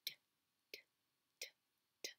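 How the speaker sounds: "d" breathed with no voice until it sounds like "t"; whispered d. Shown as a demonstration. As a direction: whisper "d" as if you have laryngitis. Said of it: "Now whisper it".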